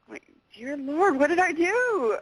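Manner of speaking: high-pitched, hammed-up panic; mock alarm. Guest retells touching a forbidden grave, mock alarm. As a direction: play up the horrified realisation, pitch climbing.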